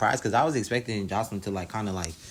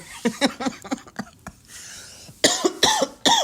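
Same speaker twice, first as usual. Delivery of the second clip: high shrieking laugh; 6 dB louder than usual. Real, not acted. Reacting to plate story.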